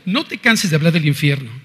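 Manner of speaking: normal talking voice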